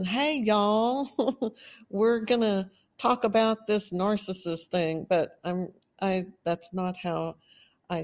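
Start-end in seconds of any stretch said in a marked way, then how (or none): 0.0-5.1 light self-mocking Texas accent, breaking into a laugh; a hint of a put-on Texas accent with a chuckle. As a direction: play up a Texas accent as a joke on yourself. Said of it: "I was told that I have a Texas accent".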